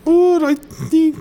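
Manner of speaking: put-on "What I need" in a high, slow British accent; squeaky, slow British put-on. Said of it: "say it in a British accent".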